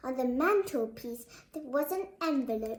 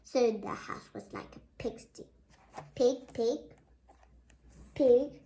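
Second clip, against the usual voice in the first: usual speaking voice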